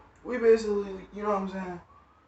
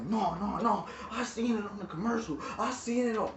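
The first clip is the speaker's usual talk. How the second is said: higher-pitched imitation of arguing; mock yelling; 4 semitones higher than usual. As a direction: insist like an irate customer, without real shouting. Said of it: "He's sitting there yelling at her like".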